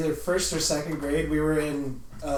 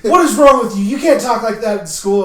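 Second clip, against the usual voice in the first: louder, higher mock scolding; scolding teacher; 6 semitones higher, 10 dB louder than usual. Teacher scolding a young kid for saying funk, mock scold. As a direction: play a scandalised teacher. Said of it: "the teacher's like".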